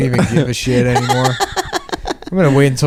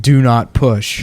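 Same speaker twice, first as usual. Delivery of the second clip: slow, spelled-out sign reading; much slower than usual. Pretend. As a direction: read it out slowly like a warning label. Imagined forehead button sign.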